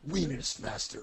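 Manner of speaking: dramatic title read, lightly voiced; B movie title voice. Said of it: "like a title for the ultimate B movie".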